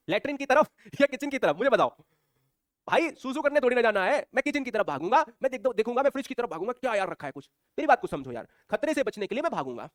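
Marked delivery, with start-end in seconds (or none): none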